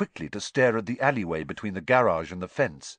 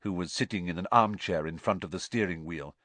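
ordinary delivery

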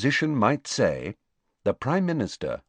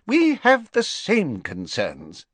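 higher, slow, firm interruption; Fudge cutting in; 10 semitones higher, slower than usual. Acted. Fudge interrupts the Prime Minister, slow and firm. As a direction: cut him off firmly. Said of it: "Fudge interrupted".

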